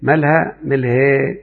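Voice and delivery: ملهى said twice slowly, the final alif bent toward ee; slow teacher demo of ملهى with imala. Shown as a demonstration. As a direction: draw out ملهى with the end leaning to ya. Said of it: "أقول".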